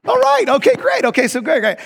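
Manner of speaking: high, loud, giddy squeal; giddy excitement. Retold or imitated. Guest told his idea will be passed on, giddy. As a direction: burst out overexcited. Giddy at promise to pass ideas on.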